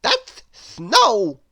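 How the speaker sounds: high, loud exasperated voice; Mister Silly crying out. A narrator's reading. Mister Silly correcting his friend, high loud cry. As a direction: correct him with a laugh in it. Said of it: "cried Mister Silly".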